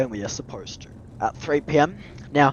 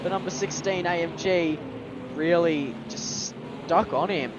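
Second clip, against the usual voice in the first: motorsport commentator; 6 semitones higher than usual. Acted. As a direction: call the lights out like live TV. Continues live race call.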